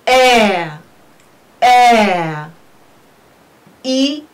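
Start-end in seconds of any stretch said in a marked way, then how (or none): 0.1-2.5 long falling air, twice; diphthong demo. Shown as a demonstration. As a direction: air drawn out as "eh-uh", no r. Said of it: "We pronounce it as".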